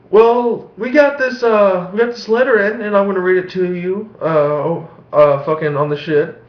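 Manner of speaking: high, put-on preacher voice; mock televangelist. Vlogger parodies a late-night televangelist, high and hammy. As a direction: lampoon a TV preacher reading a letter. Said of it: "he didn't talk like that".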